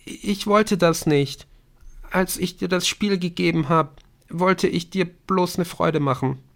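put-on high, halting girl's voice; regretful girl explaining herself; Bryn, remorseful. A narrator's reading. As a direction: apologise sadly in a high register.

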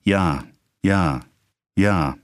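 evenly spaced, mechanical replies; measured clockwork yeses. A narrator's reading. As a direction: answer at regular intervals, automatically. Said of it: "antwortete wie ein Uhrwerk in gleichen Zwischenräumen".

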